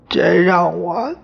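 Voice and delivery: very high, slow, wailing plea; mother crying over the shame to the ancestors; crying lament. Acted. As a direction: wail it through tears. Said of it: "呜呜地哭".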